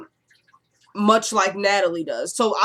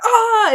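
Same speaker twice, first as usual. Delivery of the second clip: high, loud imitated scream; short hammed-up yell of pain; mock scream; 6 dB louder than usual. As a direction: imitate a man screaming, pitched way up. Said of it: "and he screams".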